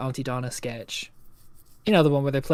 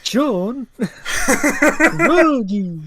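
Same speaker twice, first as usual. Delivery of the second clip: much higher, louder cartoon call; Big the Cat call; 8 semitones higher, 7 dB louder, much slower than usual. Calling for lost friends, high dopey cartoon shout.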